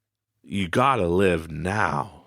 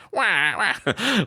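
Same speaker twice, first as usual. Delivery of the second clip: put-on much higher, babyish wah wah with a rising end; mock whining; 12 semitones higher than usual. Shown as a demonstration. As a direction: whine like a spoiled child. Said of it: "it sounds like I'm just whining and crying".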